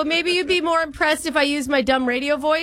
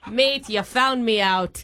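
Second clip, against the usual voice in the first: low, slow mock-Aussie drawl; hammed-up fake Australian; cartoon Aussie accent, lower and slower; put-on Australian accent; 4 semitones lower, slower than usual. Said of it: "Are you from Australia?".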